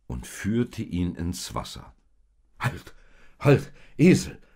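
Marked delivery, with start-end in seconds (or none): 2.6-4.4 loud, high startled shout; frightened shout. Acted. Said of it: "rief Josef erschrocken aus".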